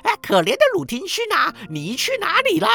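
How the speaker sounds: very high, loud parrot squawk. A narrator's reading. Parrot calls to Crusoe home from the island, high parrot voice. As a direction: cry it out like a parrot. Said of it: "派洛特在他从荒岛返家的时候问他说".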